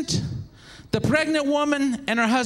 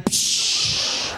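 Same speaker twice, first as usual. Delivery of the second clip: long hissing splash sound; breathy gushing hiss; water burst noise. Retold or imitated. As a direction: imitate a popped bag of water. Imitates water bag popping.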